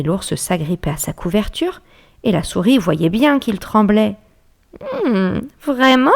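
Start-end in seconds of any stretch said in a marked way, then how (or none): 5.1-6.1 mild suspicion. Acted. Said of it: "avec un regard suspicieux".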